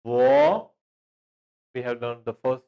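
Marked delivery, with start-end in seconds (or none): 0.0-0.7 "Wo" sung out much higher and louder; high model "Wo". Shown as a demonstration. Teacher loudly models "Wo" for the class. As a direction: call "Wo" up high to show the tone. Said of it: "wo means I".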